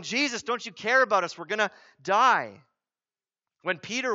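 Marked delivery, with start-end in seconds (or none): 0.0-2.6 imitated panic, quick and loud; freaking out. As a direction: retell a panicked, raised-voice appeal. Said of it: "they're freaking out".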